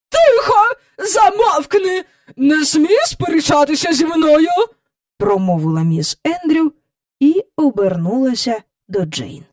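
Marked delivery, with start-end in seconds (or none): 0.1-4.5 high, loud, imperious order; angry command. A narrator's reading. Miss Andrew forbidding the boy to argue, angry. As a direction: silence him sharply. She silences the arguing boy.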